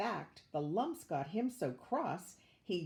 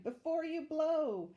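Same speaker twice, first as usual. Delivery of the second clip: eager cry; 8 semitones higher, 4 dB louder than usual. Acted. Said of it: "The children cried".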